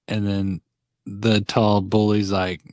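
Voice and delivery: ordinary talk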